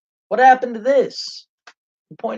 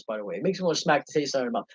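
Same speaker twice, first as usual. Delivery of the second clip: ordinary talk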